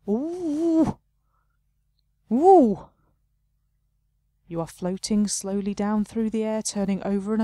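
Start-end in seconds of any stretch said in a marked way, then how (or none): 0.1-2.9 surprised ooh. Real, not acted. Falling through the rug.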